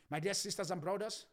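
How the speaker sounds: ordinary delivery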